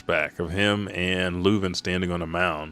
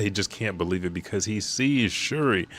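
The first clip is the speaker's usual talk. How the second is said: ordinary delivery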